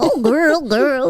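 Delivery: sing-song call to the cat; baby talk to cats. Retold or imitated. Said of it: "we're, like, different people".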